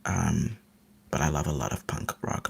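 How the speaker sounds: ordinary talk, nothing marked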